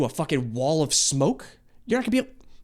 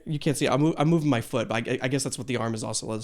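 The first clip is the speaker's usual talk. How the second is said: ordinary delivery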